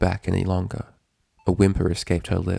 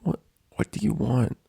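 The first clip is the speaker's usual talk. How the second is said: sniffling; 5 semitones higher, 4 dB quieter than usual. A narrator's reading. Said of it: "Dash sniffled".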